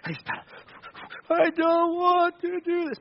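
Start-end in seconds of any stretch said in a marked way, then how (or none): 1.3-3.0 put-on high, slow, tearful wail of a child; crying child. Tired son on the bike trail tells dad, sobbing. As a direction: whimper it out like a worn-out little boy crying on his bike. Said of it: "pedaling and crying".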